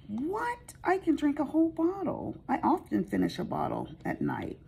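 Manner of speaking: high squeaky voice mocking a client who brags about her drinking; incredulous client. Mimicking a client who boasts she can finish a bottle, high voice. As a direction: play the client, shrill with disbelief at first. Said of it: "And she was like".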